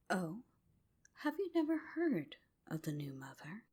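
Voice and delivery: usual speaking voice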